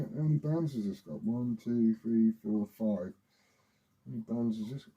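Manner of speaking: usual speaking voice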